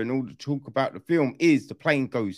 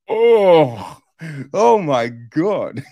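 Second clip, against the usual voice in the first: louder oh, then slow laughing oh my God; wowed laughing; 9 dB louder, much slower than usual. Retold or imitated. Viewer reacting to hammer shots, louder laughing wow. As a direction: gasp loud then laugh it out. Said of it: "you're like".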